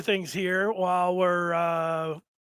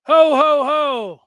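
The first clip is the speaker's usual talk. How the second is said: loud booming Santa laugh, much louder; jolly Santa ho ho ho; 5 semitones higher, 10 dB louder, much slower than usual. Pretend. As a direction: belt out ho ho ho like Santa. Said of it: "And he's saying".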